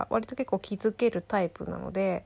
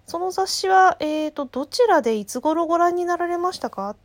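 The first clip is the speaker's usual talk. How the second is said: bright, higher polite clerk voice; chirpy phone-operator politeness; customer service voice; 6 semitones higher, 4 dB louder than usual. Retold or imitated. (高めの明るい接客ボイス)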